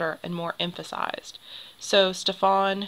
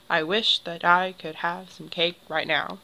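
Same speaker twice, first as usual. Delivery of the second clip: steady beat on WISH, I, HAVE, CAKE, NOW; heartbeat iambic rhythm. Shown as a demonstration. Teacher demonstrating the beat of a heart, rhythmic stress on WISH, I, HAVE, CAKE, NOW. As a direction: read it like a pulse: i WISH that I could HAVE some CAKE right NOW. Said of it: "kind of sounds like the beat of a heart".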